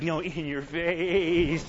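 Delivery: long drawn-out taunt; mock gloating. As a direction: stretch it out like a gloating winner. Acting out gloating softball winners.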